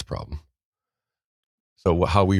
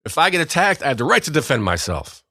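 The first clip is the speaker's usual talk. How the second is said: high, louder patriotic outburst; mock defiant rant; 10 semitones higher, 4 dB louder than usual. Telling the office he'd defend himself, in a loud mock rant. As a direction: declare your rights like a hammed-up patriot. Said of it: "that's what I said".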